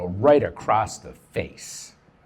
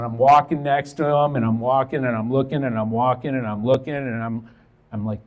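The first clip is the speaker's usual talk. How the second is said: ordinary talk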